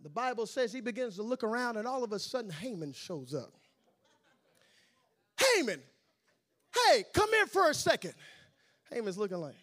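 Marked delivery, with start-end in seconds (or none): 5.4-8.2 king calling out. Acted. King calls Haman over.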